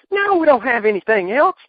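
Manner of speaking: high, loud exasperated impression; Cornette impression. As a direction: squawk it like a cranky manager. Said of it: "he goes".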